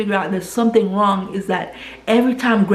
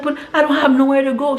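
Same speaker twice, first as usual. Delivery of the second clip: higher, fast, frightened plea; panicked plea; 6 semitones higher, faster than usual. Retold or imitated. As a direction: blurt it out, scared and lost. Stranded woman panics.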